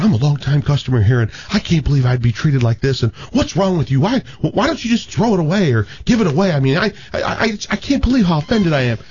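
high-pitched indignant woman; acted flustered, offended lady; upset customer. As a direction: complain in outrage, pitch climbing as you go. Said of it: "the woman gets all upset".

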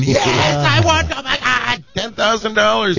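high, excited mock winner's shriek; sister-in-law celebrating a fake lottery win, shrieking; mock scream of joy.

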